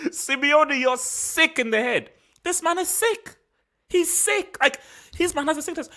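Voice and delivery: high-pitched, incredulous ranting; exasperated outrage. Real, not acted. Analyst ranting at defensive coach Simeone, high-pitched outrage. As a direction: rail at him, voice climbing high.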